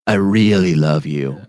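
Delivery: slow, drawn-out 'I really love you'; songwriter's revised take. Acted. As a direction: stretch out REALLY like a songwriter trying a line. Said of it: "It should be like".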